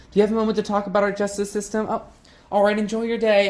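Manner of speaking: high, quick, relentlessly cheerful pitch; chipper canvasser. Acted. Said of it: "his constant chipper attitude".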